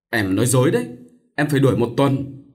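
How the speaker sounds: plain reading voice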